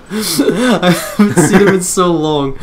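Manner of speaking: cracking up while trying to read; laughing. Real, not acted. Hosts joke makes her crack up.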